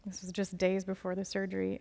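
normal talking voice

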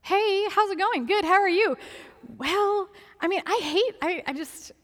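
high, falsely cheery imitation; high singsong pleasantries, then stalling; awkward phone call. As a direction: act out dreading the news behind small talk. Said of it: "that's not a fun phone call".

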